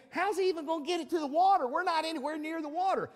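put-on high, fast voice of a baffled kid; mock-incredulous, high and rapid; incredulous son. Sons' baffled thoughts about Noah acted out.

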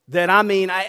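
normal talking voice

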